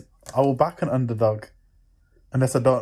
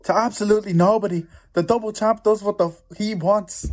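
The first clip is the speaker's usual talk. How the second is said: higher-pitched mock Irish accent, cocky; Irish accent impression; 8 semitones higher than usual. Friend finishes the fighter's famous quote in a mock Irish accent. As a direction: swagger through it in a hammed-up Irish brogue. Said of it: "can't do the accent though".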